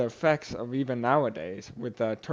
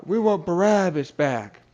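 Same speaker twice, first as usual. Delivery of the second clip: loud, higher imitation of a crowd's demand; riled-up crowd; 6 semitones higher, 5 dB louder than usual. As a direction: retell the crowd's demand, raised in pitch and volume. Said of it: "got everyone all riled up".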